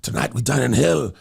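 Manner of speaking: low, guttural mock-Scottish warrior voice; guttural Scottish attempt. Shown as a demonstration. Showing co-host his guttural Scottish, low warrior delivery. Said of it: "It's guttural".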